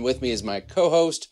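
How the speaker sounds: usual speaking voice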